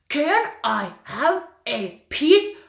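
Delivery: slow, ominous monster voice; scary weird voice. Shown as a demonstration. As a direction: creep out the listener, slow. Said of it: "a scary, weird voice".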